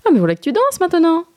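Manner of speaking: high, bright cry of joy; delighted exclamation. A narrator's reading. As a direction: exclaim it happily. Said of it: "sa mère s'écria".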